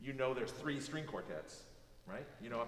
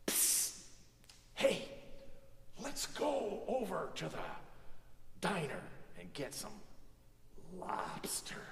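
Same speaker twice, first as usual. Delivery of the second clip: stage whisper; 4 semitones higher than usual. Shown as a demonstration. Actor on stage to the person next to them, stage whisper. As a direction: whisper it out to the back row. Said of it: "So a stage whisper is something".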